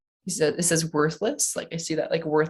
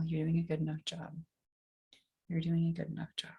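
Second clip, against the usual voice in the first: soft affirmation; 8 dB quieter than usual. Acted. Repeating tapping affirmation softly.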